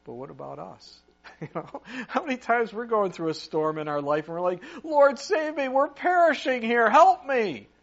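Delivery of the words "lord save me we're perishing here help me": higher and louder, pleading to be saved; desperate cry for help. Retold or imitated. Preacher imitating a believer panicking in a storm, high loud plea. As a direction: shout it up like you're drowning.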